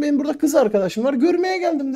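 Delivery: high, quick, outraged retort; indignant protest. Retold or imitated. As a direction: fire it back fast, insisted. Arguing with the vice principal. (müdür yardımcısına hızlı, tiz, öfkeli cevap)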